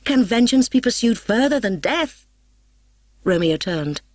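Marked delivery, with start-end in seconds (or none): 0.0-2.3 higher and louder, outraged; Paris crying out at the intruder; angry cry. A narrator's reading. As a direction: hurl the question at him. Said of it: "he cried".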